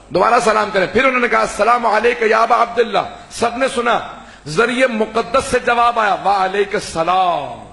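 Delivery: high, ringing majlis preaching; impassioned Urdu sermon delivery; full-force preaching. Acted. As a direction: preach it out to the whole hall.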